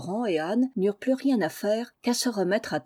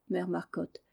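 plain reading voice

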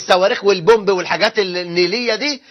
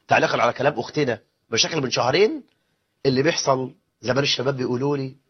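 ordinary talk

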